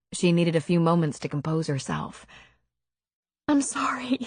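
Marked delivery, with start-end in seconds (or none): 3.5-4.2 a tearful, hushed whisper. A narrator's reading. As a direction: barely voice the apology. Tearful heroine apologizing again.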